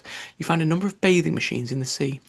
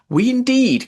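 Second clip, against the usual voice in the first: higher, louder outraged cry; trembling mouse crying out at talk of cats, louder; indignant cry; 10 semitones higher, 8 dB louder, slower than usual. Acted. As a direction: cry out in offended disbelief. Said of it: "cried the mouse".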